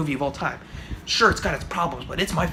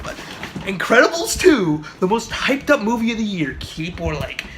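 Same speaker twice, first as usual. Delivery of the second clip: ordinary talk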